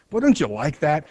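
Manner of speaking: ordinary talk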